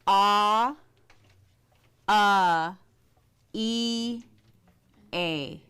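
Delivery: "Ah, uh, E, A" as separate held vowels; sound drill. Shown as a demonstration. Teacher practicing the vowels with a class, deliberate drill of "Ah, uh, E, A". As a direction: sound out "Ah, uh, E, A", pausing after each. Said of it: "continue to practice this".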